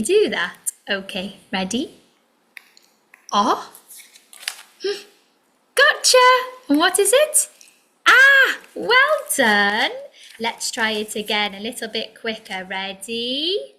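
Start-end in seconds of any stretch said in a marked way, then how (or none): 5.8-9.9 excited gotcha. Acted. Teacher pouncing on the sound in the game, excited. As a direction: gasp then a high, loud gotcha.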